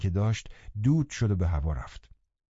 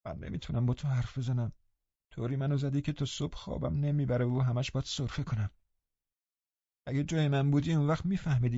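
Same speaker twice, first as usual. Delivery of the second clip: soft, higher-pitched self-pitying grumble; under the breath; 4 semitones higher, 5 dB quieter than usual. A narrator's reading. Linton blaming Catherine for his sleepless nights, under his breath. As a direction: complain softly, like a put-upon invalid. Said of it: "لینتون زیر لب گفت".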